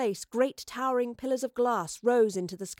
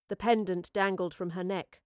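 plain reading voice

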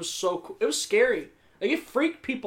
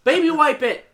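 exasperated scolding; 8 semitones higher than usual. Retold or imitated. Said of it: "I was like".

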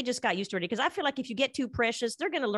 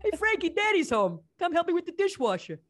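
put-on New York dad accent; 8 semitones higher than usual. A dad home from work calls Frankie in a New York accent. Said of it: "That was really good".

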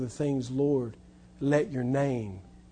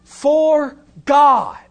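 "God" higher and louder, then a long pause; emphatic For God; 7 semitones higher, 7 dB louder than usual. Shown as a demonstration. Preacher voicing how John read it, emphatic "God" then pause. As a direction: proclaim "God" and let it hang. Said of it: "would have read the verse like this".

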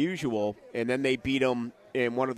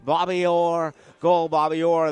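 loud, rising play-by-play shout of the goal; radio announcer's goal call; excited goal call; 3 semitones higher, 5 dB louder, slower than usual. Retold or imitated. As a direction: call it like the winning goal just went in. Famous goal call replayed.

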